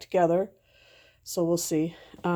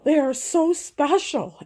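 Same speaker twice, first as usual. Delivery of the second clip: mom insisting; 6 semitones higher, 5 dB louder than usual. Retold or imitated. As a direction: insist to the kids how special it is. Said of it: "I'm like".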